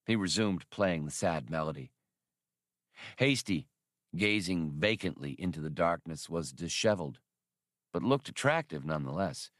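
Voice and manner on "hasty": ordinary delivery, nothing marked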